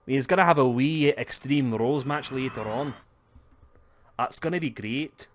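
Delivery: hammed-up Scottish banter; Scottish accent. Joking about the coming match in a Scottish accent. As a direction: lay on a playful Scottish accent. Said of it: "Scottish promos".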